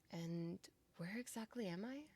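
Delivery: ordinary talk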